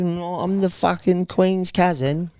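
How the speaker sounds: put-on high, slow posh British accent; fake British accent. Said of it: "like, a fake British accent".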